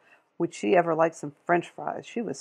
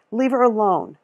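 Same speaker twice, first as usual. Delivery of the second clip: raised voice; firm demand; 4 semitones higher, 6 dB louder than usual. A narrator's reading. Lila tells the store lady to let the girl go, firm and loud. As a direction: order her to stop.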